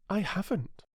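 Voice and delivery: put-on a polite young girl answering; little girl Alice. Alice's voice in the story.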